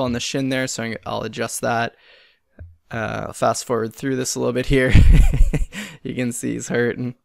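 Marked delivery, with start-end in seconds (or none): 5.0-6.0 loud laugh. Real, not acted. Laughing at his pain.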